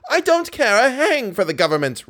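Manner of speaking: high, exasperated outburst; roared. A narrator's reading. Manager interrupts his colleague about the government, roaring. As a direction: cut in with an indignant roar. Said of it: "roared Richard".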